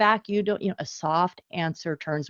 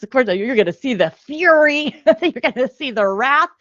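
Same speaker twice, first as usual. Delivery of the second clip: half-laughing warning, louder and higher; amused, raised voice; 6 semitones higher, 7 dB louder than usual. Real, not acted. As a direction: chuckle through the warning of fury. Predicting child's fury, laughing.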